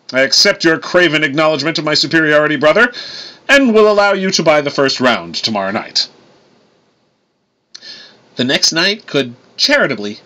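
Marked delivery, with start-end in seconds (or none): 0.1-6.1 higher, grandly magnanimous victor; boastful Thor. A narrator's reading. Thor accepting his brother's congratulations, pompous. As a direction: accept the homage like a pompous god.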